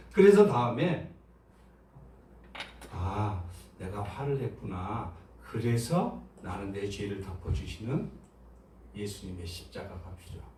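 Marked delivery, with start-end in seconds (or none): 2.9-10.5 quiet confession. Shown as a demonstration. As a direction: confess it gently to yourself. Models reflective confession of sin.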